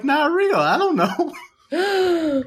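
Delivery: high, slow giggling; laughing. Real, not acted. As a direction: giggle through it helplessly. Host laughs at his answer.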